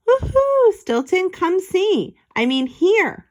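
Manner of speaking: high, loud ghostly hoot then calling; spooky calling. Acted. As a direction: call out to spook Stilton.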